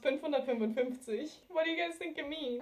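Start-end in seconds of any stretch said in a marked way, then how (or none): 0.0-1.3 fünfhundertfünfundfünfzig with round ü and z as ts; German number. Shown as a demonstration. Teacher giving the last word, careful German. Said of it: "so this word is pronounced".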